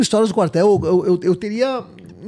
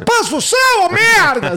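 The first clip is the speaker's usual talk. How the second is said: mock sergeant yell; 11 semitones higher, 5 dB louder than usual. Sargento gritando em casa, imaginado.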